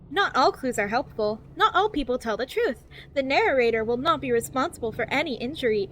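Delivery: over-enunciated guidebook narration, lilting up and down; cheery infomercial voice. Acted. Reading a survival guide to new players, cheery infomercial voice. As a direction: read it like a relentlessly positive infomercial. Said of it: "infomercial that's trying to be cheery and positive".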